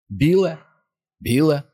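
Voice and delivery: high, anxious questioning call; trembling, exhausted call. A narrator's reading. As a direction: call out uncertainly into the dark. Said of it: "тремкий, виснажений".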